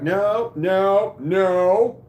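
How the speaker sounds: loud, high, drawn-out mock refusals; emphatic refusal. Employee refusing to banter with pissy manager, emphatic. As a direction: shut him down with each word.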